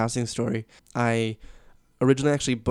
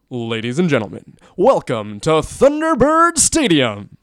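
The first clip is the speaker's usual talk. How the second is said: big, slow stadium announcer boom, much higher and louder; announcing voice; 5 semitones higher, 11 dB louder, slower than usual. Shown as a demonstration. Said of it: "now that's my announcing voice".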